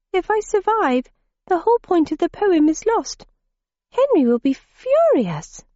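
put-on high girlish voice; young girl voice. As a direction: play a stubborn young girl.

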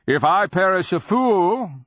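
loud, high defiant cry; cried out. A narrator's reading. Nonman defying the beast, crying out. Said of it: "the Nonman cried".

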